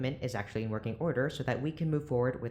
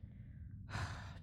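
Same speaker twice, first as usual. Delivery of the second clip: weary sigh; 12 dB quieter than usual. Acted. Doctor exasperated by Vic.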